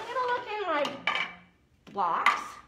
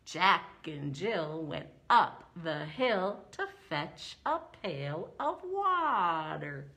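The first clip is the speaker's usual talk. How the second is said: slow beat, lower voice; rhythmic recital; 5 semitones lower, slower than usual. Shown as a demonstration. Teacher demonstrating poem rhythm to children, slow and steady. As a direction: mark the rhythm of the poetry slowly. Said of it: "That's part of the rhythm of the poetry".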